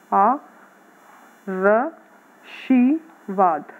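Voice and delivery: आ र शी वाद said slowly, each syllable apart; slow syllable-by-syllable dictation; broken into syllables. Shown as a demonstration. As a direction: sound out आ र शी वाद one syllable at a time. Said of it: "इसको pronounce करेंगे तो क्या होगा".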